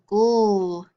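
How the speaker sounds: GHOUL as a drawn-out "gul" ending; isolated syllable sound. Shown as a demonstration. Teacher drilling sound cards, GHOUL as a held syllable. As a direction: hold the end sound of bugle, GHOUL. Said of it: "do our letter sound cards now".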